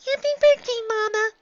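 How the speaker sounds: put-on very high child voice, shouted; a little filly cheering for mom; young filly shouting. A narrator's reading. Daughter cheering her mother's birthday, very high child voice. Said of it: "Dicky shouted".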